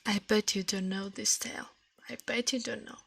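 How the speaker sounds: usual speaking voice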